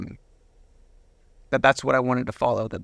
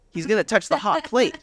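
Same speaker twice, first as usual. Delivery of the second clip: laughing it out, higher; 8 semitones higher than usual. Real, not acted. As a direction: chuckle through the punchline. Kid touches hot plate anyway.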